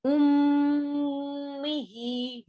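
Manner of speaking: उम्मिही with a held nasal ghunna on the mim; nasal hold on meem. Shown as a demonstration. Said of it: "मीम पर गुन्ना होगा".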